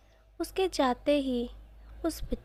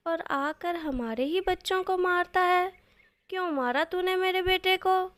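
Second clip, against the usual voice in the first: put-on raised, higher voice telling off a naughty kid; angry scolding; 4 semitones higher, 5 dB louder than usual. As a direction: scold him loudly like a furious mother. Mother scolds boy who hit son.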